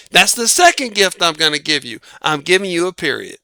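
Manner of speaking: ordinary talk, nothing marked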